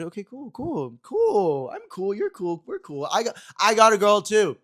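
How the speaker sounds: high, loud, flustered cool-cool babble; flustered bluff. Retold or imitated. Bluffing to a friend about a girl, flustered and high. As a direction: fake being unbothered, voice climbing.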